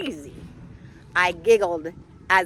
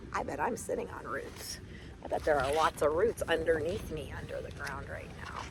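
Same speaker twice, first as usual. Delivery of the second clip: 1 hushed aside; 5 semitones lower, 8 dB quieter, faster than usual. Real, not acted. Quiet aside to children.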